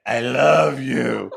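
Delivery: slow, deliberate I love you; slow earnest confession. Retold or imitated. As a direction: lean into each word. Lover repeats confession slowly.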